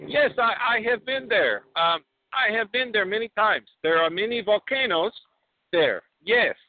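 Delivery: put-on flustered Borat, Kazakh accent. Borat bluffs about Georgia to the host, Kazakh accent.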